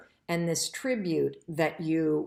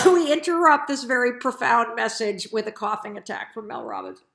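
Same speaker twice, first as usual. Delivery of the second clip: mock news bulletin; 5 semitones higher, 7 dB louder than usual. Jokes about her coughing attack.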